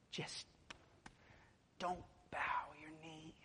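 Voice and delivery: hushed plea. Real, not acted. Preacher urging listeners one last time, in a whisper. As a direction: breathe it as a final urging.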